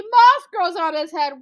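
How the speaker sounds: loud, high mocking jab; mocking warthog. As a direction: jeer it loudly as the warthog. Warthog mocks Anansi.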